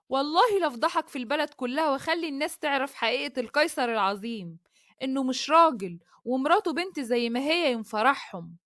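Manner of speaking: usual speaking voice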